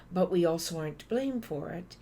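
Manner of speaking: ordinary talk, nothing marked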